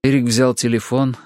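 ordinary delivery, nothing marked

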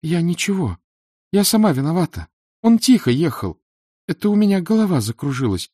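put-on high-pitched, tearful-tinged girl; apologetic girl. A narrator's reading. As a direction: plead in a high young voice. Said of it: "обратилась она ... и всхлипнула".

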